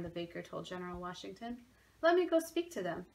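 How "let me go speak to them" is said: eager. A narrator's reading. Baker volunteers eagerly.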